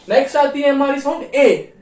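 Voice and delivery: ordinary talk, nothing marked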